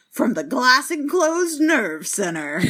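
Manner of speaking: slow, drawn-out radio ident voice; hammed-up broadcast voice; radio station announcer. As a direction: imitate a station announcer, stretching it out. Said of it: "it always goes".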